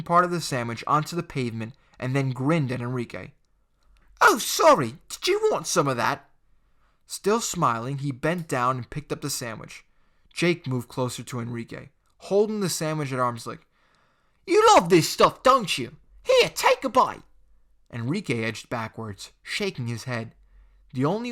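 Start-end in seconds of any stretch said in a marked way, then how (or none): 4.2-6.3 high, loud fake sorry; taunting bully; mock apology. Jake grinning mock apology. 14.4-17.2 high, loud menacing tease; jeering teen; taunting bully. Acted. Jake forcing sandwich.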